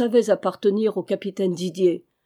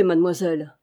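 ordinary delivery, nothing marked

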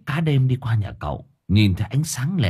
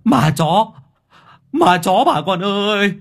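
very high, loud panicked screaming; terrified yelling; 12 semitones higher, 4 dB louder than usual. Acted. Fisherman flees screaming to villagers about ghost dog, very high. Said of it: "miệng ông gào".

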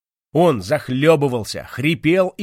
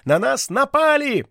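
high, loud alarmed yell; shouting; 13 semitones higher, 5 dB louder than usual. Acted. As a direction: cry out that they're under attack. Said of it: "закричал он".

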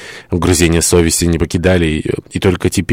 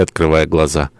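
ordinary delivery, nothing marked

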